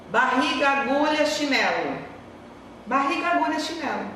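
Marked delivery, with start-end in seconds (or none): 2.8-4.1 mock nonsense list. Shown as a demonstration. Teacher shows pupils a meaningless string of words, puzzling mock delivery. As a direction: say the random words as if talking nonsense on purpose. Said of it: "O que que você tá entendendo?".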